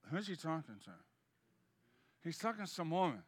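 low, hushed muttering among the disciples. Acted. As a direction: murmur it so Jesus can't hear.

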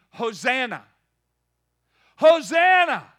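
loud, high rising shout of Hosanna; full-throated Hosanna shout; crowd yelling. Retold or imitated. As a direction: shout it like a crowd welcoming a king. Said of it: "they were yelling".

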